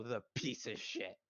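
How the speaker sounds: usual speaking voice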